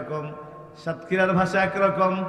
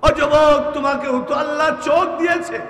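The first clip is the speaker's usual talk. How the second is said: put-on high, loud fiery call to the young man; preaching at full force; 10 semitones higher, 9 dB louder than usual. Preacher calling out to the young men, fiery and loud. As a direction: thunder it out to the youth.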